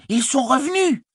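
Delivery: very high-pitched excited announcement; surprised cry. A narrator's reading. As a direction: cry the news out high, astonished. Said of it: "cria-t-il". (cri aigu et surpris)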